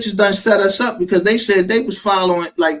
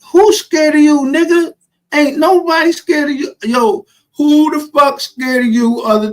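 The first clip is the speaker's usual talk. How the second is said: defiant retort; 11 semitones higher, 4 dB louder than usual. Real, not acted. Retorting to the guy.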